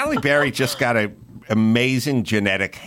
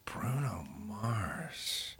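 whisper; 18 dB quieter, much slower than usual. Real, not acted. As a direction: breathe the name to yourself. Guessing the answer under breath.

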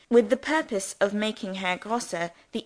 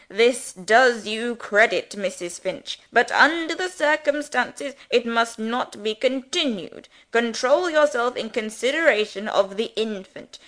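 put-on louder, grandiose clergyman over a screaming baby; self-important parson raising his voice; pompous rector declaiming; 3 semitones higher, 6 dB louder than usual. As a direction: boom it out over the baby's screeching.

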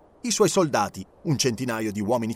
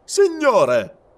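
sharp, much higher and louder protest; offended outburst; 10 semitones higher, 7 dB louder than usual. Acted. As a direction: bristle at being lectured. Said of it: "rispose il capitano". (capitano offeso, esclamazione alta e forte)

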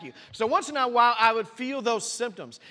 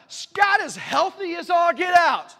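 higher, louder, slow preaching shout; 9 semitones higher, 8 dB louder, slower than usual. Real, not acted. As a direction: belt it out to the congregation, slowly. Preaching punchline.

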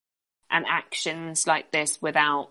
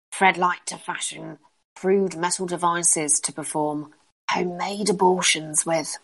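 British crone voice. Acted. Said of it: "to have you do a British crone voice".

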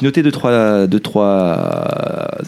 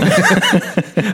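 high burst of giggling; high giggle; 8 semitones higher than usual. Real, not acted. Cohost giggles after opening his capsule, high bursting giggle. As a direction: giggle at the silly toy.

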